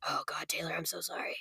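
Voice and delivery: hushed whisper of contrition; whispered apology. Retold or imitated. Whispering sorry to [name] like a guilty fan. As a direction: breathe the apology almost silently. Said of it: "I'm like".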